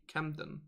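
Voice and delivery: a quiet test of "Camden", both syllables; D pronounced. Shown as a demonstration. Asking whether to pronounce the D, quietly trying "Camden". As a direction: say "Camden" softly with the D sounded. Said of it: "Or do we pronounce the D?".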